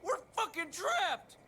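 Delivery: high frantic yell; panicked yelling. Acted. Pilot realizing they're trapped, frantic yell. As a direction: shout in alarm.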